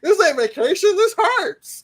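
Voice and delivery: high, hammed-up cry of pain; comic high-pitched whining protest; acted cartoon complaint. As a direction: yelp it like a hurt cartoon character.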